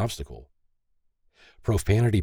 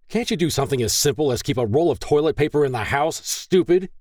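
fast, higher-pitched angry outburst; angry shout; 8 semitones higher, 3 dB louder than usual. A narrator's reading. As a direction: shout it as an enraged scolding. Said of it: "David shouted as he kicked".

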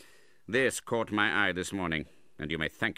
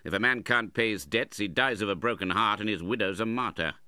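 louder, raised scoffing voice; scornful uncle; 4 semitones higher, 5 dB louder than usual. A narrator's reading. Uncle scoffs at the widow's grief, contemptuous. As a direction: dismiss it with loud contempt.

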